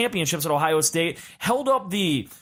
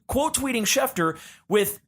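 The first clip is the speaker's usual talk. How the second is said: ordinary talk, nothing marked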